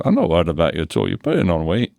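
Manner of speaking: quick, lightly put-on Scottish accent; a hint of Scottish brogue. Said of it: "This is my attempt at Scottish accent".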